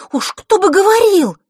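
put-on very high, loud girlish retort; indignant outburst. A narrator's reading. Girl can bear it no longer, indignant outburst. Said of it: "не выдержала девочка".

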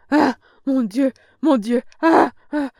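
high, loud, broken wailing; old woman whimpering in a broken voice; broken moaning. A narrator's reading. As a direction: moan it between gasps. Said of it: "geignait d'une voix entrecoupée".

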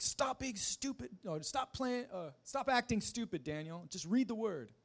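high-pitched imitation of a teacher losing patience; snapped teacher. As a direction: scold a pupil in a high, exasperated voice. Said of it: "finally she just snapped".

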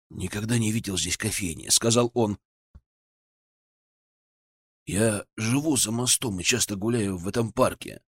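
soft, low, drowsy voice; sleepy voice. Acted. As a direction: talk as if barely awake. Sleepy guest talks.

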